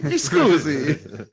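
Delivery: mi scusi drawn out slowly at a normal pitch; slow Italian mi scusi. Retold or imitated. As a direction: let mi scusi trail out slowly. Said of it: "He goes".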